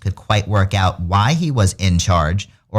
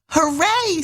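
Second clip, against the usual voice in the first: high squealed hooray; squeaky cheer. A narrator's reading. Garden animals cheering the bunnies' wedding news, squealing high.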